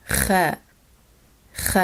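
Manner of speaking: Ch as a raspy back-of-mouth kh; Ch scraped at the back of the mouth; Spanish jota sound. Shown as a demonstration. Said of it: "same sound as the Spanish jota".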